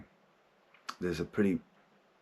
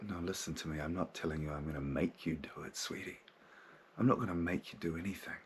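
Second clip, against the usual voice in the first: much softer, tender calming voice; gently reassuring; 8 dB quieter than usual. Acted. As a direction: soothe a frightened child. Soothing his scared inner child.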